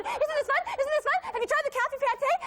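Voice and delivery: put-on lightly done French accent, pitched high; imitated French accent. Reporter quoting woman from France, high French accent. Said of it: "she said it in a French accent".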